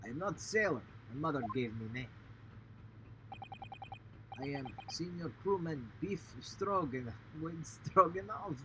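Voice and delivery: put-on gruff Russian accent, lightly done; Russian sailor voice. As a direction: play the proud Russian crewman.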